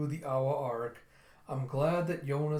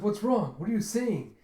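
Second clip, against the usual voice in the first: high, louder mock alarm; startled questioning; 7 semitones higher, 4 dB louder than usual. Yona to a strangely smitten Gija, startled questioning. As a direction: blurt it out, taken aback. Acted alarm at odd behaviour.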